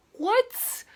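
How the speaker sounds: high, loud, disbelieving what; shocked what. Retold or imitated. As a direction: jump way up in disbelief. Shocked reaction to the refusal.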